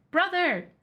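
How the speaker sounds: a high call across the water. Acted. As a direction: sister goblin calling her brother across the river, high call. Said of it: "the sister goblin called out".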